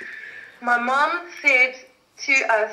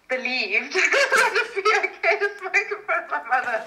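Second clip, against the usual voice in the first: talking through real laughter, pitch jumping up; laughing; 5 semitones higher than usual. Real, not acted. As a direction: tell it while cracking up. Laughs while telling the story.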